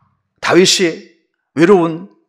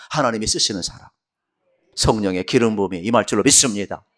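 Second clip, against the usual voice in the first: usual speaking voice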